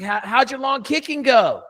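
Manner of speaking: high, innocent-sounding trap question; pointed high question. Retold or imitated. Coach asking the kicker about his practice, high and innocent. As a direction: ask it high, like you don't know the answer. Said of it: "I was like".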